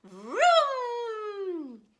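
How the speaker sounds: high loud engine noise; engine vroom. Acted. Goose lifting off the bank, loud high vroom. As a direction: roar like a jet taking off.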